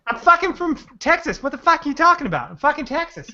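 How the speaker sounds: indignant put-on Texan; Texas accent. Joking with friends in a hammed-up Texas accent. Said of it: "that's a great Texas accent".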